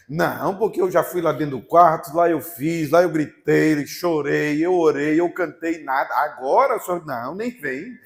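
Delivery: loud, insistent protest, listing every grievance; retold indignant complaint. Preacher replaying his complaint to God in prayer, indignant. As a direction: protest loudly, punching each verb.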